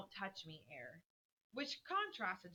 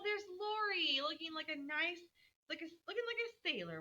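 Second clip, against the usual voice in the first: lightly voiced excited girl, pitched up; eager Beth at window; 6 semitones higher than usual. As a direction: rattle off what she sees, thrilled.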